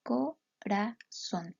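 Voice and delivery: "Corazón" said very slowly, syllable by syllable; slow syllable count of "Corazón"; split into syllables. Shown as a demonstration. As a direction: spell out co-ra-zón slowly for counting. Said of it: "realizar el conteo silábico".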